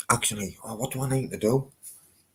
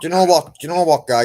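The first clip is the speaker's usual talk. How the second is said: excited; 7 semitones higher, 11 dB louder, faster than usual. Real, not acted. Sudden warm outburst on phone.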